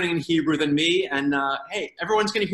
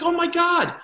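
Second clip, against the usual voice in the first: sudden realization; 9 semitones higher, 3 dB louder than usual. Retold or imitated. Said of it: "I'm like".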